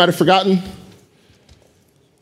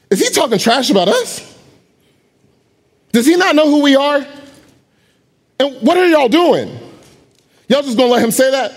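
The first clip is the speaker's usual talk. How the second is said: fired-up David; 6 semitones higher than usual. Acted. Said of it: "he goes".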